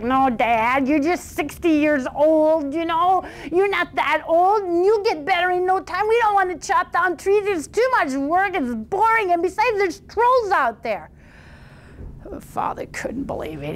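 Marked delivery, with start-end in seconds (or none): 0.0-11.1 put-on higher, whiny teenage voices; whining sons. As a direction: whine like lazy sons dodging work. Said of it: "And they're like".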